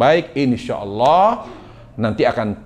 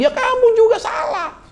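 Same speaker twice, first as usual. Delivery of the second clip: much higher, laughing retort; squeaky mock back-talk; mocking talk-back wife. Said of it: "Dia ngomong".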